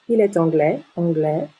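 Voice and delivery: anglais ending on a vowel, no S; silent final S. Shown as a demonstration. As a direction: let anglais rise on a bare è. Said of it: "Le S est une lettre muette".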